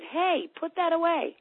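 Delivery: high, drawn-out mild mock yell. Imagined parent nagging about clutter, mock yell. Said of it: "nobody yelled at you and said".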